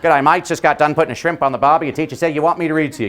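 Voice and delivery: put-on Australian accent; Australian guy. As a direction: play a chummy Aussie guest reader. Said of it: "read as an Australian guy".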